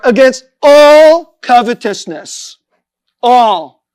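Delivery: "all" very loud and drawn out; stress on ALL. Real, not acted. Preacher drives home the warning, stress on "all". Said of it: "I want to emphasize that word".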